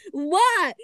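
high, loud little-sister reply; high falling call back. A narrator's reading. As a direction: pipe up loudly as the little sister. Little sister answers Froggy's call.

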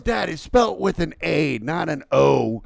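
put-on high, loud child correcting Dad; imitated daughter. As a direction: insistently set Dad straight as the little girl. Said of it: "she goes".

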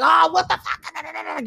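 high, slow exasperated cry; a put-on freakout at the broken-down car; mock freakout. Stranded driver, car died on the road, exasperated high cry. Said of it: "any other time I would've been like".

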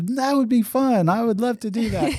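high-pitched eager acceptance; imitating the girl. Girl accepting a party invitation, imitated high and eager. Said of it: "And she goes".

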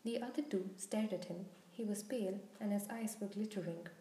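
usual speaking voice

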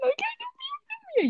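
very high squealing coworkers; excited squeal. Retold or imitated. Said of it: "ellas ahí que gritando".

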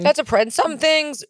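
sudden crack into a squeak. Real, not acted. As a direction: voice cracks up high mid-word. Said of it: "sorry, my voice cracked".

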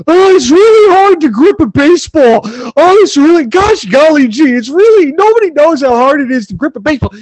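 mock complaint in a high, whiny voice; whiny Gerrit Cole. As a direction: whine it like a sulky baby, hammed up. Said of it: "You sound like a whiny bitch".

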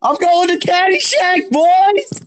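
high, gleeful taunting call; gleeful shout. Acted. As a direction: crow it to wind the boys up. Fake-out vote, gleeful taunt.

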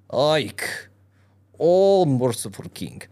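drawn-out, mock-pitiful wail with a loud swell; pathetic lament. A narrator's reading. Tomcat begs the king for help, pathetic lament. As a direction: lament pathetically in a hammed-up, almost Irish lilt. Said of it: "pathetically to lament".

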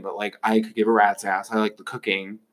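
normal talking voice

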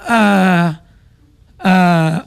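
ordinary delivery

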